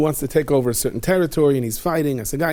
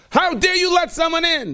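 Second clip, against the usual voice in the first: furious yell. Acted. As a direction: tear into the guards. King yelling at guards.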